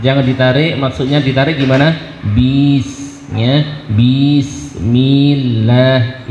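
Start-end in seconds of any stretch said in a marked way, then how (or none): 3.9-6.3 Bismillah with the b stretched out; wrong drawn-out bismillah. Shown as a demonstration. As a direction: drag the opening of Bismillah. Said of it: "Itu dihindari seperti itu".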